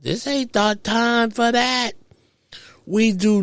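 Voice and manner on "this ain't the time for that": frantic inner protest; panicked protest. Retold or imitated. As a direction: plead fast that now is not the moment. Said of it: "I'm like".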